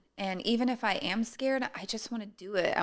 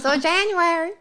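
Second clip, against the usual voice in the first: put-on Southern accent; 10 semitones higher, 10 dB louder than usual. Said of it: "You like my Southern accent?".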